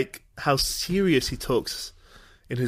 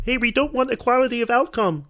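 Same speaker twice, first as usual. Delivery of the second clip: Jordan Peterson impression; 7 semitones higher than usual. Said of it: "I just think of Jordan Peterson".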